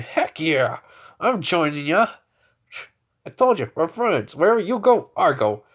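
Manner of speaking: loud, high, eager vow of loyalty; eager pledge. Acted. Mark tells his friend he's joining the guild, eager and loud. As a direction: throw yourself in with rising energy.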